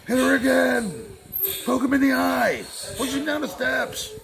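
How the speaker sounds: high, slow heckling yell; yelling from the stands. Pretend. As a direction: heckle like a rowdy spectator. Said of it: "I'm gonna yell comments".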